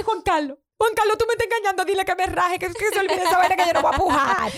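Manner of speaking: frantic pleading. Retold or imitated. Retold pleading to her husband.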